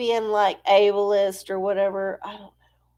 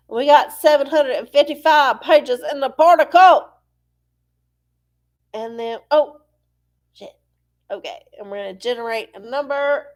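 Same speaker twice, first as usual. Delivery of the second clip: ordinary talk, nothing marked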